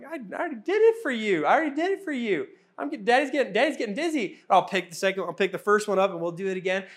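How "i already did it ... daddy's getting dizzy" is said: higher, fast protest of a worn-out dad; a dad pleading with his daughters after spinning them; tired dad. Retold or imitated. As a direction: plead for a break, dizzy and laughing. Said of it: "I'm like".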